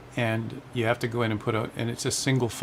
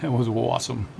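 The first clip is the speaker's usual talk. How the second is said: put-on rough stab at a Bostonian accent; attempted Boston accent. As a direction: have a go at sounding like a Bostonian. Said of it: "trying to say it like a Bostoner, but I can't".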